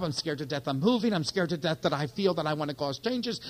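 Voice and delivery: ordinary talk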